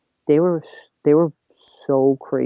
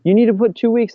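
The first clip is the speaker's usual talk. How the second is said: high imitation of protesting bosses; mock indignant; 8 semitones higher, 4 dB louder than usual. Bosses demand two weeks from quitting employee, indignant. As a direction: act out their objection, pitched way up.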